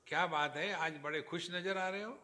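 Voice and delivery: loud, fast playful question in Hindi; teasing greeting. Retold or imitated. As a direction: call out to him, loud and quick. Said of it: "I asked Gupta ji".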